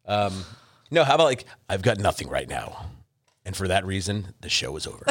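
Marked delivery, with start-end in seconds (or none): 1.7-5.0 movie voice. Shown as a demonstration. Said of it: "We'll just do it like in a movie voice".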